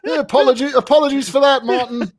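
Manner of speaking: high, hammed-up sorry; mock apology. Co-host apologising to Martin for Valtteri's gesture, mock sorry.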